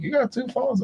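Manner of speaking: mock disbelief, voice jumping way up; incredulous quote. Quoting baffled friends with high incredulous surprise. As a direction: imitate people who can't believe it. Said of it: "They're like".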